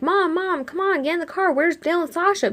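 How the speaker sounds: high, urgent mock call. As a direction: call to your mom as if from afar. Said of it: "I'm calling out to her".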